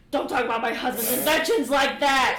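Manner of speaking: furious shout, high and loud, half laughing; indignant yell. Acted. Lolth yelling at Wolf for insulting her husband's inventions, furious shout. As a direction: scream it in outrage at the insult.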